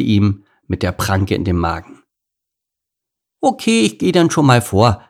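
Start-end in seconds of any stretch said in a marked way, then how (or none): 3.4-5.0 put-on high, loud little-girl voice; high-pitched, cheeky kid; breezy lion cub. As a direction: announce it airily as a child. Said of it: "sagte Laila".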